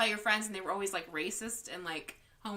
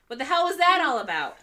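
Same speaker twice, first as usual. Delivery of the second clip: loud, higher outraged snail; indignant snail; 6 semitones higher, 11 dB louder than usual. Acted. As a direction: bellow it like a fed-up snail who got thrown. Snail's punchline in a joke.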